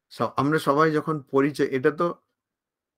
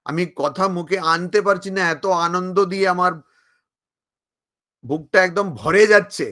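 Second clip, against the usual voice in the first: ordinary talk, nothing marked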